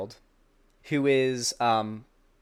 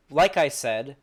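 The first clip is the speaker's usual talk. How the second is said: normal talking voice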